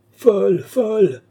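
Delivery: loud, very high, drawn-out wail; stretched shriek of despair; frantic cry. A narrator's reading. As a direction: wail it out, stretching each word. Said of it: "criait Lorenza sans écouter Balsamo".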